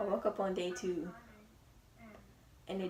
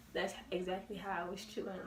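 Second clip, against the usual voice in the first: usual speaking voice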